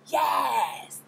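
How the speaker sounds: loud high shout; loud high cheer. Real, not acted. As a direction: yell your approval. Cheering the booty grip.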